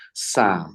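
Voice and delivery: thin sa sound; light sa. Shown as a demonstration. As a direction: say sa with thin tongue.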